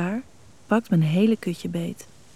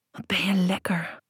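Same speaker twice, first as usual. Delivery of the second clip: growled whisper; 4 dB quieter than usual. Acted. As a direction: hiss it out close and hungry. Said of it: "gromt hij". (gegromd gefluisterd)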